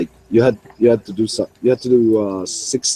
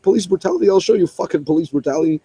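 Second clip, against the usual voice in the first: imitated angry cop; 7 semitones higher, faster than usual. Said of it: "he was so angry".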